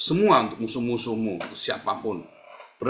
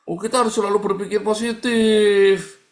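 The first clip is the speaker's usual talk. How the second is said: higher, louder sing-song sanctimonious voice; mock positivity preacher; 9 semitones higher, 6 dB louder than usual. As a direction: parody a toxic friend preaching positivity. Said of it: "Nah, itu hati-hati". (nada tinggi mengejek orang sok positif)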